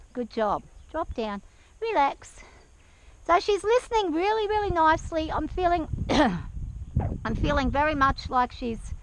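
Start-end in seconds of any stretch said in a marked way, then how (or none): 5.9-7.2 harsh throat-clearing coughs; coughing fit. Real, not acted. Coughing on hair.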